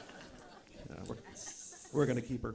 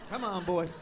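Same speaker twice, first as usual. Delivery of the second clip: higher-pitched coaxing call to a dog; lilting, raised dog-calling voice; mock coaxing; 8 semitones higher, 4 dB quieter than usual. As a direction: coax an old dog along. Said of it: "it's just like you're mocking him".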